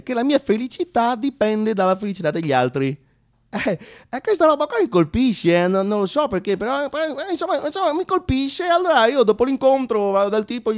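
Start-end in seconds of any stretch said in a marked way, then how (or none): none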